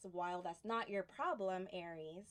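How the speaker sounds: ordinary talk, nothing marked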